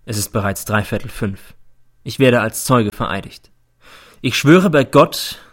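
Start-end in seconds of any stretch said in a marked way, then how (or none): 4.2-5.4 slow, loud, raised declaration; solemn oath. A narrator's reading. Witness swearing in before the court, solemn. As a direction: proclaim it formally.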